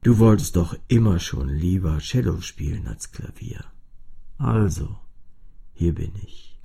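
deutlich tiefer, einladend; tiefe Cellostimme. A narrator's reading. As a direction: stell dich als Cello vor. Cello spricht zu Fini. (tiefe, freundliche Cellostimme)

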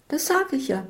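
shrill it back at her. A narrator's reading. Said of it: "schrillte Isabel".

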